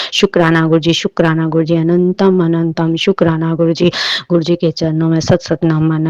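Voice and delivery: loud, fervent thanks to the guru; loud devotional thanks. Acted. Devotee thanks her guru before the congregation, loud. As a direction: praise the guru at full voice.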